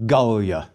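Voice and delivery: Gallia as GAHL-lee-a; accent the first syllable of "Gallia", Latin-style; first-syllable stress. Shown as a demonstration. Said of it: "we accent the first syllable".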